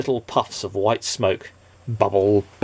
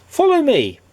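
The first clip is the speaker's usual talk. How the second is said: much higher brisk call; cheery Mister Happy; 5 dB louder than usual. Acted. Mister Happy leading the nobody home, high bright call. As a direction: beckon him along brightly.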